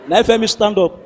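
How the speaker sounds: usual speaking voice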